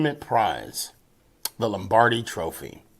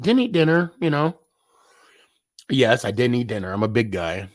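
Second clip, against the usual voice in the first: usual speaking voice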